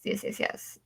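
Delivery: whisper. Real, not acted. Muttered agreement to herself.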